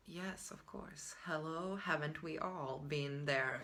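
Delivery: very soft, slow, sarcastic aside; sarcastic quote. Retold or imitated. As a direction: drawl it out quietly with mock weariness. I was like.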